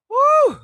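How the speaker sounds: high, loud "woo"; venting whoop. Real, not acted. Got the rant out of her system, high loud whoop. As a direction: let it all out in a high whoop.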